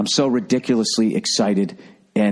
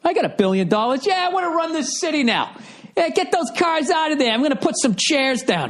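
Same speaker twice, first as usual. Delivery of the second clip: much higher, smug rich-guy voice; mocking Bloomberg. Comedian mocks the billionaire mayor, high smug voice. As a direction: play him as an entitled billionaire, pitched way up. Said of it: "the mayor in New York there, Bloomberg".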